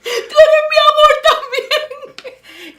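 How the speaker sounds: very high, loud, slow sobbing laughter; overwhelmed crying-laughing love cry to Jesus. Retold or imitated. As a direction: pour it out through tears and laughter. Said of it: "yo empecé a llorar".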